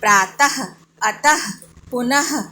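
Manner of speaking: प्रातः, अतः, पुनः each ending in an audible h; separated words with breathed "ha" endings; visarga as h. Shown as a demonstration. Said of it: "ह पढ़ा।".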